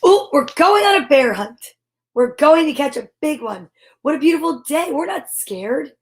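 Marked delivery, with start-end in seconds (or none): none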